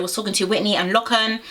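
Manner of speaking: normal talking voice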